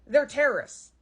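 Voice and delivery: ordinary delivery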